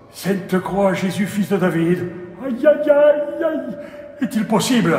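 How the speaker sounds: high, loud lamenting cries; anguished wailing. Acted. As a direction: wail in despair at the news. Priest despairs, cries out in anguish.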